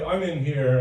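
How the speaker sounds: normal talking voice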